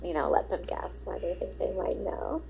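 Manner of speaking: usual speaking voice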